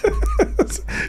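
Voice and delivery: breathy high giggle; high unvoiced laugh. Real, not acted. Laughing after mocking Triple H. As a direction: laugh at your own impression.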